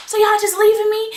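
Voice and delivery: high, loud mock disbelief; acted-out outraged squeal; indignant protest. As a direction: cry out as if betrayed by friends. Abandoned by friends, retold protest.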